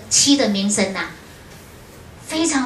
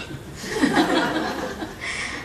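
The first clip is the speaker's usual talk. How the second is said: breathy laugh; 5 semitones higher, 6 dB quieter than usual. Real, not acted. Laughs at her naive request.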